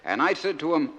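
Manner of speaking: naive outport soldier son reading his letter; broad dialect, lively comic recitation; George from Crinkle Cove. Acted. Soldier son writing home to Mama and Dadda, naive and earnest.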